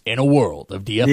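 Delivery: a hint of a deep trailer announcer; movie trailer voice. Shown as a demonstration. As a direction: intone it like a film trailer narrator. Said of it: "movie trailer "in a world".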